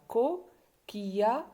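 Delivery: slow "Coquillage" in three beats, co... quilla... ge; pauses inside "Coquillage", final "ge" fully voiced; split into syllables. Shown as a demonstration. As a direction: sound out "Coquillage" piece by piece. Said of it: "trois syllabes".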